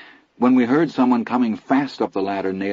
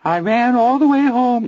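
very high wavering falsetto singing; 5 dB louder than usual. A narrator's reading. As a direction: croon it in a dreadful falsetto. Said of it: "wavered in a dreadful little Anthony falsetto".

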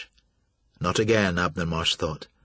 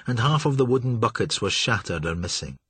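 ordinary delivery, nothing marked

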